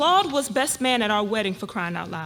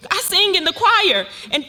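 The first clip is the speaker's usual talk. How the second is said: higher and louder, distraught; panicked outcry; 5 semitones higher, 8 dB louder than usual. Acted. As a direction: cry it out in panic. Wife panicking about church.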